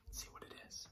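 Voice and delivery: soft suspenseful whisper; whispering before the reveal; hushed. Real, not acted. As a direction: draw them into the surprise quietly.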